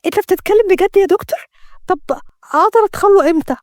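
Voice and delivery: high-pitched, eager disbelief; light up with relief, voice lifted high; joyful. A narrator's reading. Woman to the doctor after good news, joyful. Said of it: "ليلى بفرح".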